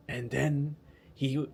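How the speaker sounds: slow, hammed-up French play narrator; badly faked French accent, drawn out; mock-dramatic French storyteller, sliding toward German. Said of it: "I can't do French accent".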